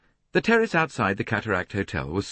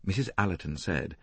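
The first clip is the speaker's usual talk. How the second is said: ordinary delivery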